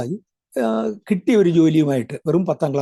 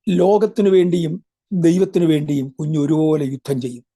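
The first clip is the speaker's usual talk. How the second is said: prophetic proclamation; 3 dB louder than usual. Retold or imitated. Preacher retelling the prophecy spoken over him as a child, solemn proclamation. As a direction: proclaim it as a message from God.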